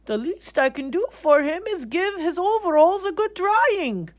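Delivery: mulling it over kindly; thoughtful artist. Acted. Artist deciding to dry the bear's overalls. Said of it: "said the man thoughtfully".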